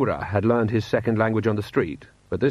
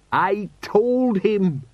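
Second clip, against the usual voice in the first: very slow, high exclamation; pride or dread; 10 semitones higher, 3 dB louder, much slower than usual. Acted. Sergeant dwells on insulting a lord, proud or dreading. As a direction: draw every word out high in disbelief. Colon relives his defiance.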